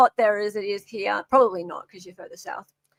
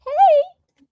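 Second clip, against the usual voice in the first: high squeaky hello to the cat; baby voice for the kitty; sudden high pet greeting; pet talk; 8 dB louder than usual. Acted.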